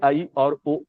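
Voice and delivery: ordinary talk, nothing marked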